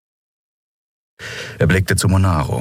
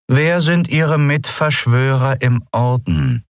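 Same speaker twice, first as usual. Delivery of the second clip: high, slow, pressing questions; cold interrogation; 8 semitones higher, slower than usual. A narrator's reading. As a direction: drill each word into him. Interrogation resumes.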